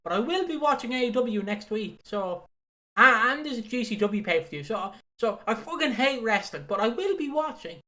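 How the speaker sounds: hammed-up fan moaning about wrestling; complaining fans. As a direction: rant like a bitter fan who still tunes in. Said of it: "And they're going".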